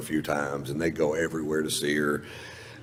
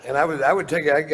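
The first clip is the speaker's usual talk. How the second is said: usual speaking voice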